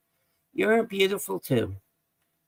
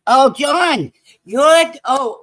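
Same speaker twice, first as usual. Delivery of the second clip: excited call out; 10 semitones higher, 8 dB louder, much slower than usual. Real, not acted. Spots John in chat.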